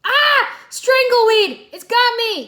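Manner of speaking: very high, loud panicked shriek. A narrator's reading. Cole shrieks as the weed grabs him, panicked. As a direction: scream in terror as it grabs you. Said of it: "he shrieked".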